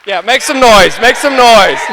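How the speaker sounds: loud, high hype shout; calling out to the crowd. Real, not acted. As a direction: pump up the room with a shout. Hyping the wedding crowd.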